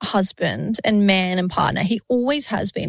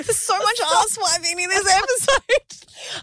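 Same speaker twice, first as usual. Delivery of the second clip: laughing; 10 semitones higher than usual. Real, not acted. Friend laughing about the episode's running joke. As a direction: giggle it out in disbelief.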